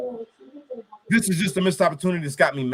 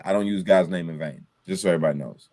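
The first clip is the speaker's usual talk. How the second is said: ordinary talk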